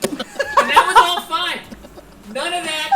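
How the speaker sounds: normal talking voice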